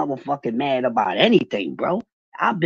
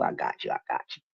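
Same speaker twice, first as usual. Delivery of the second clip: whispered reassurance; 9 dB quieter, faster than usual. Real, not acted. Soft reassurance to niece.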